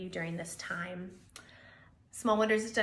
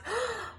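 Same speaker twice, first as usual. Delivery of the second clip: gasp; 12 semitones higher than usual. Acted. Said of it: "Bear gasped".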